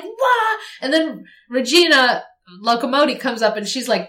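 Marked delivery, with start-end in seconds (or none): 0.0-0.6 high mock whimper. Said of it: "And he's like".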